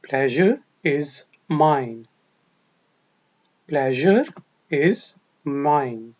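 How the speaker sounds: slow, word-by-word model; slow chunked teacher demo; slow broken-up phrase. Shown as a demonstration. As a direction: break it into pieces for learners. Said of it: "ये मेरे लिए खुशी की बात है".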